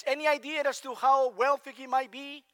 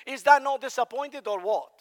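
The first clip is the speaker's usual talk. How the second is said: ordinary talk, nothing marked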